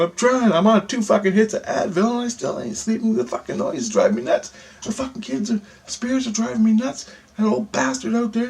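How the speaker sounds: high-pitched, worked-up retold complaint; exasperated rant. Ranting to her about the noise driving him nuts, exasperated high voice.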